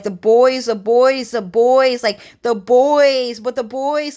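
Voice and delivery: hammed-up insistent friend; repeating the boys.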